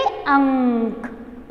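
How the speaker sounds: "अंक" very slow with a held nasal; correct pronunciation. Shown as a demonstration. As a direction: stretch the ng in "अंक", then close on k. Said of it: "तो हम उसे कैसे pronounce करेंगे?". ("अंक" में अनुस्वार लंबा खींचकर)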